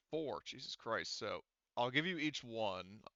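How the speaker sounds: ordinary talk, nothing marked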